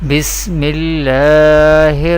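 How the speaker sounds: measured, reverent recitation; Quranic recitation. Acted. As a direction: recite it like the start of a tilawah. Recites ta'awwudh and basmala.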